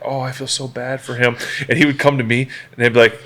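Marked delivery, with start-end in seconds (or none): 0.1-1.4 pitying murmur. Retold or imitated.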